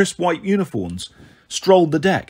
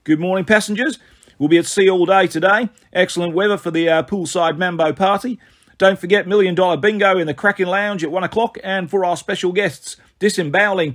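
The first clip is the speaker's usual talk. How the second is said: put-on chirpy Australian accent announcer; cheery Australian intercom voice; 5 semitones higher than usual. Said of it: "some guy with an Australian accent".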